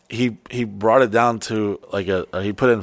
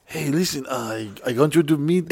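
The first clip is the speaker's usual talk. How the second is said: soft mock Latin accent, pitched up; Carlos; 3 semitones higher, 6 dB quieter than usual. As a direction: imitate a friend with an imagined Latin accent. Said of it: "Carlos has zero accent".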